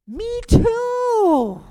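high, loud eager reply; excited confession. Retold or imitated. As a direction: blurt it out with delight. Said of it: "And she says".

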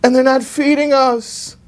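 put-on high, slow pleading kid's voice; whiny son. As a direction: beg like a homesick boy asking for cash. Son's letter home begging money.

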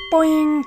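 bouncy boing; spring noise; cartoon boing. Playful sound effect.

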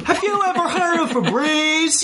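high, laughing mock question to a stinky monster; cheeky taunt. Host mocking the stinky creature, high and giggly. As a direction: tease him with a giggle in a squeaky voice.

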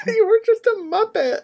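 squealing high through real laughter; helpless laughter. Real, not acted. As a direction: crack up while teasing. Cracking up at the bad accent.

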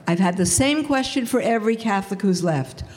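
ordinary talk

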